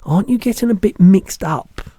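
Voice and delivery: put-on a curious little girl protesting; Sophie's voice.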